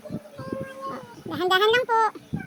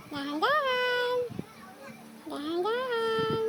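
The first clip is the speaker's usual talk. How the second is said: soft, very drawn-out guidance; slow gentle coaxing; 7 dB quieter, much slower than usual. Real, not acted. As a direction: stretch the last syllable as the pen moves. Parent guides child's tracing, slowly.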